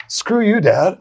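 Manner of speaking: loud, high-pitched mock fury; retold angry outburst. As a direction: blurt it out at your dad, playing up how mad you were. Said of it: "I was, like, so mad".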